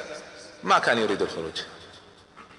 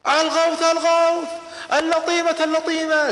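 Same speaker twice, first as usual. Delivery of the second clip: desperate scream; 11 semitones higher than usual. Acted. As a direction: scream it out like a man raising the alarm. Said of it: "وبدأ يصرخ". (صراخ استغاثة عالٍ)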